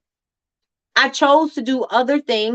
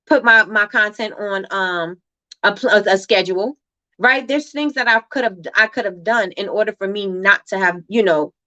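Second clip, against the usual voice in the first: normal talking voice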